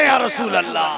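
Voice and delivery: loud, high devotional cry; called response. Shown as a demonstration. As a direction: shout it for the crowd to echo. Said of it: "जरा कह दे". (ज़ोर से पुकारा गया नारा)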